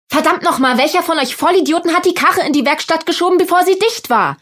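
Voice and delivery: laut und hoch gebrüllt; brüll die Männer voller Wut an; wütendes Brüllen. A narrator's reading. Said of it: "brüllte ich". (brüllt wütend ihre Mitarbeiter an)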